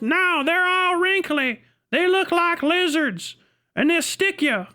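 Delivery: a dim local with a threat. Retold or imitated. Hick answers about girls.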